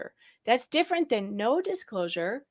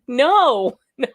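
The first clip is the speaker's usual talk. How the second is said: loud, high, slow alarm; laughing mock alarm; 6 semitones higher, 10 dB louder, slower than usual. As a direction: shoo the kid off, laughing. Alarmed at kid wanting headphones.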